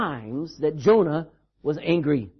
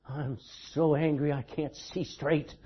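low, soft imitation of an angry mom; quietly seething mother; 6 semitones lower, 8 dB quieter than usual. Mom to her child, quietly seething. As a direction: imitate mom's anger, held low and quiet. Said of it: "My mom would look at me and she said".

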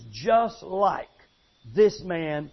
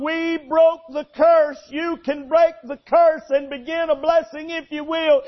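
fervent preaching; 7 semitones higher, 6 dB louder than usual. Retold or imitated. Old preacher's testimony, preached hard.

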